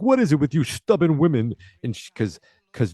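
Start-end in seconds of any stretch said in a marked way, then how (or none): 0.0-1.5 Fonzie scolding. Retold or imitated. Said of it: "And then he's like".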